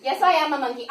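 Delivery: plain reading voice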